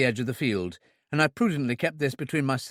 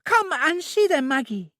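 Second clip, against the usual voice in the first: put-on high, loud childlike invitation; eager child blurting it out; sudden high childlike plea; impulsive Trudy; 4 dB louder than usual. A narrator's reading. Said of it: "She said impulsively".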